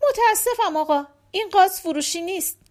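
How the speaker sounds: high-pitched innocent refusal; simple, artless reply. Acted. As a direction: decline politely and guilelessly. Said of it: "نلی با سادگی جواب داد".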